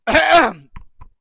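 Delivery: a sudden high, loud choked sob; real tears catching in the throat; crying breaks through, sob caught in the throat. Real, not acted. Streamer breaking down mid-sentence.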